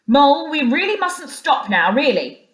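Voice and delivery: hurried call over the shoulder; calling back. A narrator's reading. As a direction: shout back, hurried and firm. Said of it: "he called back".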